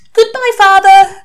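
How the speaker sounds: high, loud, slow call across the field; calling goodbye. Acted. Daughter calling goodbye to her father across the airfield. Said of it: "called Hollyberry No Feathers McCoy".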